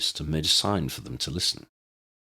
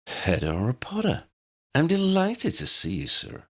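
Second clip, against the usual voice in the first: purring head teacher; 10 semitones higher than usual. A narrator's reading. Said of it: "the head teacher purred at him".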